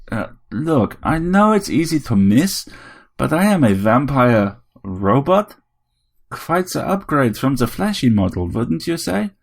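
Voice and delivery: high, lilting vampire show-off; boastful vampire robot. A narrator's reading. Vampire robot tells Harry about its upgrade, boastful.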